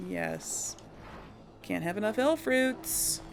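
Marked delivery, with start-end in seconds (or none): none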